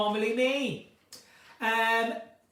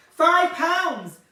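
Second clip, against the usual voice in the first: louder, hollered sales cry; mock market trader calling the price, higher and louder; market stall trader; 6 semitones higher, 9 dB louder than usual. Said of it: "I feel like a market stall".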